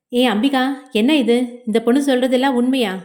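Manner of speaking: ordinary talk, nothing marked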